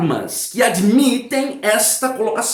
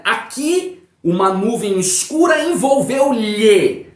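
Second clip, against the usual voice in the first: "Aqui" held, then a break before "uma nuvem escura envolveu-lhe", read slowly; slow teacher reading with a pause after "Aqui"; intonation pause; 3 semitones higher, slower than usual. Shown as a demonstration. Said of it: "pausa natural na entonação".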